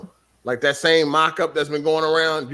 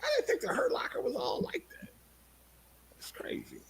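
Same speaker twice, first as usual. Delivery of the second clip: breathy hushed whisper, much softer; 11 dB quieter than usual. Real, not acted. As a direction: drop to a whisper. Hushed aside about the movie.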